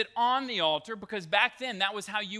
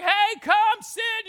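raised, high-pitched call; 12 semitones higher, 8 dB louder, slower than usual. Acted. Prophets calling on Baal, high mock shouting. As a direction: call up to a god who won't answer. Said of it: "they start calling on him".

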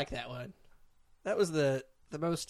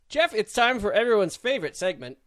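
announcer voice; 7 semitones higher, 9 dB louder than usual. Acted. Announcing the segment.

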